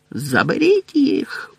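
high, slow spitting order; spitting roar. A narrator's reading. Crouch roaring at the dementors. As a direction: spit the command. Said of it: "бризкаючи слиною, загорлав він".

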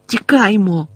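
high, urgent call to run; оклик. A narrator's reading. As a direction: call out to the others to flee. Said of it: "гукнув він". (тривожний високий оклик)

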